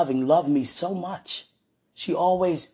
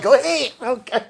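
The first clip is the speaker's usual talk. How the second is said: eager go-ahead; 8 semitones higher, 7 dB louder than usual. Retold or imitated. Retold permission in moment.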